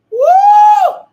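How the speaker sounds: high shouted woo; ecstatic whoop. Real, not acted. Preacher whooping to the congregation, loud high woo. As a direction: let out a joyful preacher's whoop.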